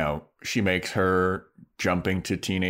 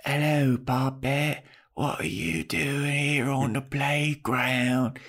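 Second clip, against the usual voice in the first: put-on higher, slow accented vampire voice; hammed-up odd accent, words drawn out; Drusilla; 4 semitones higher, slower than usual. Vampire woman approaches a lone kid, in a slow odd accent. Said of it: "I can't get over this accent".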